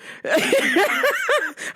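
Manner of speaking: a burst of high shrieking laughter; high-pitched laugh. Real, not acted. Cracking up at a meme of pelvic thrusts, high laugh. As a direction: crack up hard at the memory.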